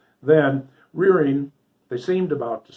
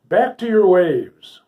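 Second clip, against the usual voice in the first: a loud, higher, slow command; cried out; 6 semitones higher, 7 dB louder, slower than usual. A narrator's reading. Harper orders the raging water steeds back, crying out. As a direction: drive them back with your voice. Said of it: "cried the little harper".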